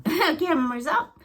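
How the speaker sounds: high, slow, laughing repeat. Real, not acted. Psychic amused by her vision, laughing it out. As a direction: let the laugh lift it way up.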